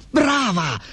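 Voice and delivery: high, pleased exclamation; delighted praise. A narrator's reading. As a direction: cheer the wife's clever plan. Gosto praises his wife's plan. (esclamazione acuta di approvazione)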